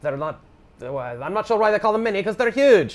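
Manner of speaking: high-pitched comic Scottish accent. Shown as a demonstration. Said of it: "that was just my Scottish accent".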